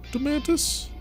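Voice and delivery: high, startled question; surprised high squeak. Real, not acted. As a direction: squeak the name in disbelief. Confused by double follow.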